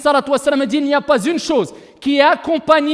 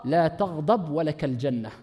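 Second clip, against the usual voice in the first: low, quiet, slow; hushed; 9 semitones lower, 8 dB quieter than usual. Acted. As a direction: murmur the promise. Soft take before loud repeat. (murmure lent et grave)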